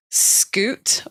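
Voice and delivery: "scoot" with a hard sk, drawn out; American guess at Shute. Shown as a demonstration. Guest guesses an American reading of surname, "scoot" with hard sk. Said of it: "If I was American, maybe I would say".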